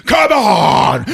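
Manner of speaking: loud, high shouted dog voice; hammed-up attack-dog shout; dogs as characters. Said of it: "Hugo and Chuco are on the car like".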